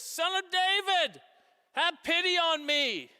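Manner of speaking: loud pleading call for pity; crying out. Retold or imitated. Blind man begging Jesus, calling out loud.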